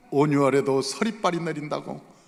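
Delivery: usual speaking voice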